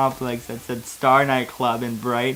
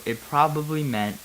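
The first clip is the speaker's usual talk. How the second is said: usual speaking voice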